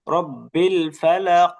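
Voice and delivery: রব্বিল ফালাক without lingering on the l; chanted teacher's model of রব্বিল ফালাক; no holding on lam. Shown as a demonstration. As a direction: move from রব্বিল straight into ফালাক, echoing the qaf. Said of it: "ধরে রাখা যাবে না".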